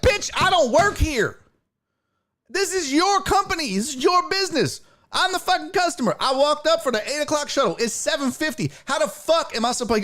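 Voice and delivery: very high-pitched retold rant; outraged protest. Customer tells supervisor whose job it is, outraged. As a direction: hurl the blame back, incredulous.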